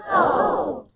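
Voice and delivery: high-pitched "Oh" of excitement; excited "oh". Shown as a demonstration. Group exercise saying O to mean excitement, excited.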